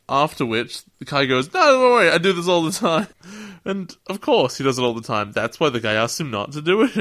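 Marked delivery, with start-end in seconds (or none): 1.5-3.1 high, loud, carefree holler; mock-casual yell, pitched way up; breezy call back. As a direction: shout back that it's fine. Kai calls back to boat guy.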